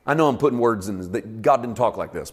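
ordinary delivery

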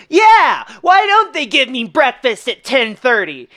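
high, loud mock cry of agreement; riled-up dad. Petty dad watching the movie, mock outrage. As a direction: play an aggrieved guy hollering at the screen. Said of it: "if you're a dad Sigma male guy".

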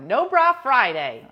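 cheerful announcement of a theme day; slogan call. Real, not acted. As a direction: proclaim it like a holiday.

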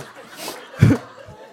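big breathy burst of laughter; loud laugh. Real, not acted. Pastor laughing to the congregation at the memory, hearty laugh. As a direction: laugh out at your own story.

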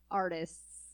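"artists" drawn out slowly with a falling tune, dripping irony; in air quotes. Real, not acted. Mocking the festival's so-called performers: an ironic, drawn-out "artists". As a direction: say "artists" as if holding it in scare quotes. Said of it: "and I'm gonna use quotes again".

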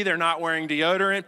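normal talking voice

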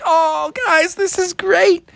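high, giddy frog voice; thrilled frog. Acted. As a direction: squeal with delight as the frog. Froggy thrilled by juice.

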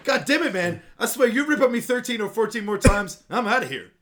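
hammed-up fed-up guy; exasperated outburst. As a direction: blow up in mock exasperation. Exasperated character quote.

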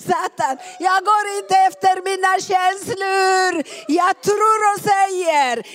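loud, high defiant declaration; shouting. Shown as a demonstration. Preacher declaring faith against Satan, triumphant shouting. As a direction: shout it in triumph, full force. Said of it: "jag skriker".

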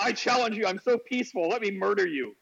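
high, hammed-up threat; mock battle challenge. Streamer teases Chris as a peaceful challenger, in a high mock threat.

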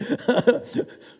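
a high, airy chuckle; breathy high laugh. Real, not acted. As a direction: let a laugh break out high. Laughs at his own remark.

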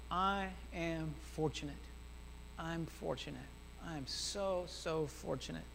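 low, soft and measured; quiet affirmation. Pretend. Preacher modelling words for someone needing God, quiet affirmation. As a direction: say it softly, as if to yourself.

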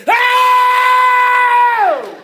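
long, high, loud "oh" holler; mass shout imitated. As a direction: hold a full-voiced shout, then let it fall. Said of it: "that whole shelter shouted".

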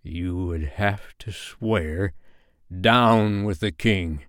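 very slow pirate captain's threat; through the teeth. Acted. Hook answering John's question, slowly through his teeth. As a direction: squeeze it out through gritted teeth. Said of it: "Through Hook's teeth came the answer".

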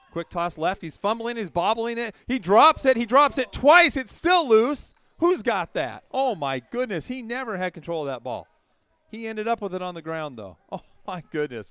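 loud, high fumble call; excited play-by-play. Acted. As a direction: shout the scramble for the ball.

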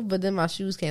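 normal talking voice

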